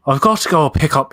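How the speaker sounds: a hint of Irish lilt in a hushed voice; quick whispered Irish imitation; lightly done Irish accent; Irish accent impression. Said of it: "she sounds like Saoirse".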